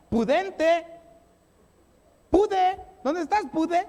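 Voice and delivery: high, loud, drawn call for little Pude; calling a child. Pretend. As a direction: sing out for the child to come. Parent calling a child named Pudente.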